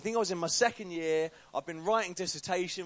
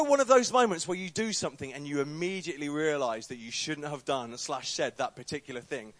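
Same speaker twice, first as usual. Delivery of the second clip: usual speaking voice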